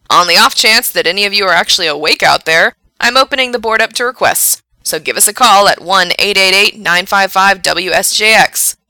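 loud, projected radio host on air; late-night radio DJ. Acted. Frank asks late-night listeners to call in, loud DJ voice. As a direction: push it out brightly to sleepy listeners. Radio DJ opens request line.

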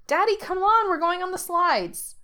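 put-on high little girl voice calling back; a small daughter calls her dad to follow; high childlike call. A narrator's reading. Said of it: "she calls behind her".

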